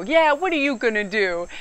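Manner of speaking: loud, cocky and high challenge; defiant taunt. Retold or imitated. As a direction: dare him, loud and cheeky. Defying her angry husband.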